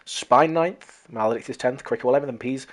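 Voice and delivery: normal talking voice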